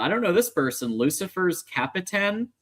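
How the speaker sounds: normal talking voice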